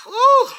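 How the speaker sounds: a high, loud "woo" exhale, half-laughing at the memory; mock pain cry. Recalling childbirth pain with a mock high whoop. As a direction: let out a sharp high whoop of remembered pain.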